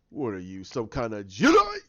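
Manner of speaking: low taunt building to a loud last word; retold villain taunt. Attacker mocks Finn using the Force, acted taunt. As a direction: start low, then burst out on Jedi.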